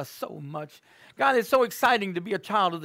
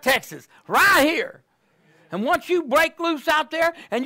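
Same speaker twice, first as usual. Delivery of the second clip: louder, higher preacher's call to the congregation; full-force preaching; 8 semitones higher, 6 dB louder than usual. Acted. Preacher rousing the congregation, full-force preaching. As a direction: preach it out to the room.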